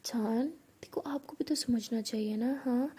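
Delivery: soft, near-whispered pleading; hushed and gentle, coax her round; tender coaxing. Acted. Pihu asks her girlfriend to understand, tender coaxing.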